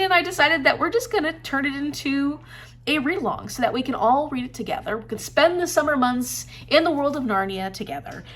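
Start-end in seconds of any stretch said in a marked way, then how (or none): none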